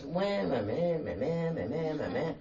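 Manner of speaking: nonsense meh-meh babble mimicking her talk; mock blah-blah voice. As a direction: imitate her as meaningless meh meh noise. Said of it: "No idea what she said".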